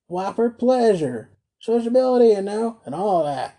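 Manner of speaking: high, loud character reply; mildly exasperated. A narrator's reading. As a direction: raise the voice as if spelling out the obvious. Tackleton pushing his scornful invitation.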